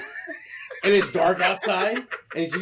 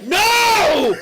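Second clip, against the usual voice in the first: high, loud, long yell; shouted refusal; 13 semitones higher, 8 dB louder than usual. Retold or imitated. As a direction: scream the refusal down the phone. Acted shouted refusal to scammer.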